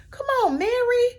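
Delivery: high, cheering call to Mary; excited cheer. Real, not acted. Fan cheering Mary on, high and excited. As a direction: hype her up.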